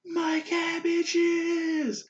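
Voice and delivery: high, very slow, drawn-out cry of despair; cabbage merchant wail. Retold or imitated. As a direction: wail it like his whole cart just burned. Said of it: "And he's like".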